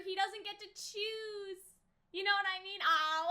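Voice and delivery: usual speaking voice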